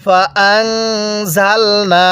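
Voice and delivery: ফাআনজালনা very slow with a held nasal hum on the n; nasal ghunnah. Shown as a demonstration. Teacher demonstrates ghunnah on ফাআনজালনা, very slow. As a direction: hold the নুন with ghunnah through the nose, slowly. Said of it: "নুন সাকিনটা গুনাহ করে পড়তে হয়".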